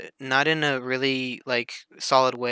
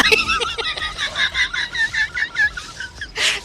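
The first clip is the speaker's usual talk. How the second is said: higher, slower, cracking up mid-curse; a fake rant that dissolves into laughs; laughing mock exasperation. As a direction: swear in disbelief while laughing. Mock outburst breaking into laughter.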